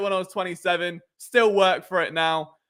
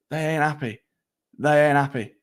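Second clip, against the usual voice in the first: ordinary delivery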